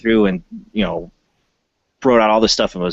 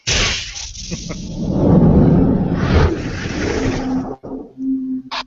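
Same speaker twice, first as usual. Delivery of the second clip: high giggle. Real, not acted. Laughing after creepy voice.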